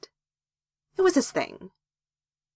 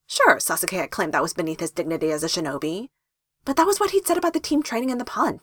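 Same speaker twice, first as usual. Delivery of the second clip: plain reading voice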